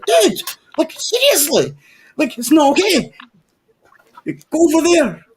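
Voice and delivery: high, pleading protest; nervous objection. Retold or imitated. Asking the armed guard to stop waving his gun, high pleading protest. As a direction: push the gun away with a squeaky plea.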